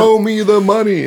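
high, drawn-out playful quote; mock catchphrase call. Interviewer teasing the band about record deals, mock catchphrase call. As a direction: stretch it out as a hammed-up movie line. Quoting a film catchphrase in jest.

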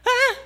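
very high loud wailing ah; imitated baby crying out; toddler wail. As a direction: burst into a toddler's cry. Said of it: "she starts crying".

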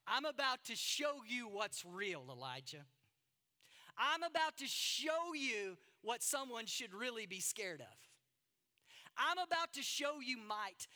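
rising preached announcement; booming proclamation. Acted. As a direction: proclaim each line like thunder. Voicing God's proclamation.

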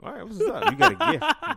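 high, loud laughing praise; excited. Real, not acted. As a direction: hype them up, laughing.

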